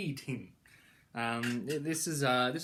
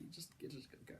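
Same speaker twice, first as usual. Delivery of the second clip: whispered; 20 dB quieter, much faster than usual. Acted. Repeating the verdict as aside.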